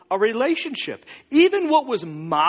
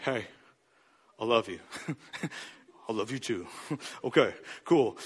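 much lower and softer, sheepish near whisper with small laughs; hushed make-up; 12 semitones lower, 9 dB quieter than usual. Retold or imitated. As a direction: murmur it awkwardly after the fight. Hushed post-fight making up.

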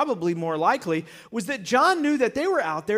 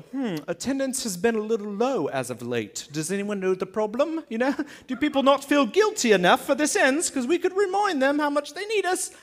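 mock British accent; 3 semitones higher than usual. Priests fret over empty temple, mock British accent. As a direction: put on a comic British accent, musing then rising. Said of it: "I don't know why they're British".

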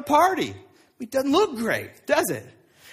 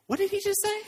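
high, softer incredulous question; taken aback; 9 semitones higher, 4 dB quieter, faster than usual. Acted. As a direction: act stunned by what you just overheard. Levi reacts to being called sick.

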